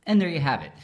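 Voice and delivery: ordinary delivery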